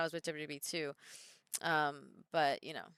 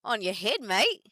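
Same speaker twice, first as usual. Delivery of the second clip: put-on Australian accent; 3 semitones higher, 8 dB louder, slower than usual. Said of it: "they'll be like".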